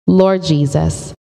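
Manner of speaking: normal talking voice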